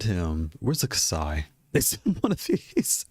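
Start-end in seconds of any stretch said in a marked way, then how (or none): 1.7-3.1 laughing disbelief. Acted. Imagining the wrong box arriving.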